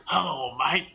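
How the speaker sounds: low, drawn-out Australian "Hello, mate"; broad Australian accent. Shown as a demonstration. Friend showing how the Australian actor should sound, Australian accent. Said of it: "You need to really accent the Australian thing".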